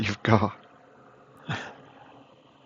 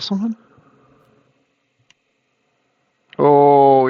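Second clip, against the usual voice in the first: surprised; 5 semitones higher, 5 dB louder, slower than usual. Real, not acted. Surprise at shields.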